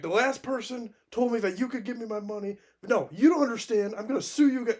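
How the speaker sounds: high-pitched, imitated yelling at the phone; angry phone rant. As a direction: hammer it high, imitating a furious caller. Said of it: "And then he finally is like".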